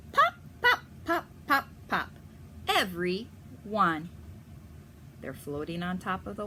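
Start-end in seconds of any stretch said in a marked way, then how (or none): 0.1-2.1 very high, bright pop pop; popping up sounds. Acted. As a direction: bob each duckling up to the surface. Ducklings popping up.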